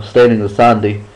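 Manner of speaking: ordinary delivery, nothing marked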